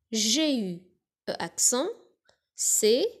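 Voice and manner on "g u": ordinary talk, nothing marked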